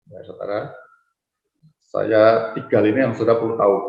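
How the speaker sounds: ordinary talk, nothing marked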